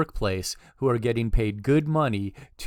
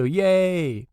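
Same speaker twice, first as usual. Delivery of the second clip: higher, louder little cheer; happy cheer; 7 semitones higher, 5 dB louder than usual. Real, not acted. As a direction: cheer the success. Code ran without errors.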